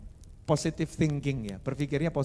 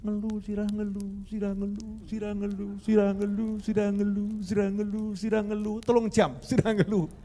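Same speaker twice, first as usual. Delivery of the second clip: monotone chant, repeated over and over; hammed-up repetitive moaning about a headache; mock nagging complaint; 3 semitones higher than usual. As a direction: drone on like a tireless complainer. Imitates endless whining to spouse.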